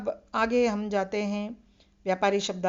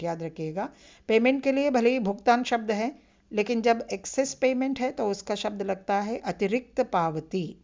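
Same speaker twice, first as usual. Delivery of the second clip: usual speaking voice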